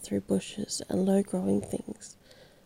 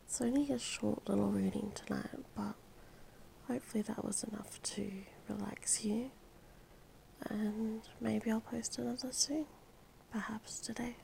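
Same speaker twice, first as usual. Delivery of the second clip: soft whisper; 13 dB quieter than usual. Real, not acted. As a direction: whisper a gentle goodnight to sleepy listeners.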